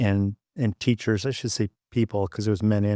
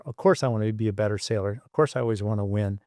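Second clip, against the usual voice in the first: ordinary talk, nothing marked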